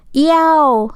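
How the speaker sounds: high, loud yelp of pain; pained howl. A narrator's reading. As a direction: yelp as if scalded by boiling water. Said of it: "the wolf howled in pain".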